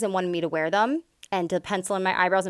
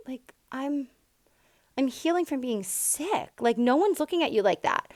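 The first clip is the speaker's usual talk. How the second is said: hurt disbelief; 6 semitones higher than usual. Retold or imitated. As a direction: say it like the words just stung you. Retorts to husband's hurtful remark.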